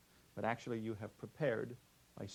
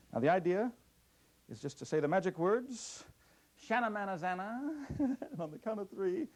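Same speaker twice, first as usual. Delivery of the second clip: put-on kids party magician; 4 semitones higher than usual.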